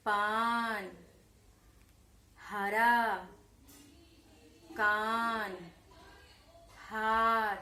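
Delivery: slow, clear teacher drill with pauses; repeat-after-me word list. Shown as a demonstration. Teacher to young pupils, reading out words to repeat. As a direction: say each word for children to echo. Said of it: "इन शब्दों का उच्चारण आपने मेरे साथ किया".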